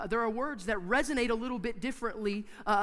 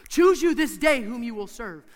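slow, booming challenge to a nation; forceful, drawn-out charge; loud admonishment; 3 semitones higher, 12 dB louder than usual. Retold or imitated. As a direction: thunder it out like a preacher quoting Joshua. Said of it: "he gives this admonishment".

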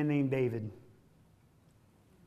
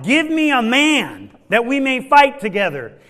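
Goliath's challenge; 5 semitones higher, 8 dB louder than usual. Acted.

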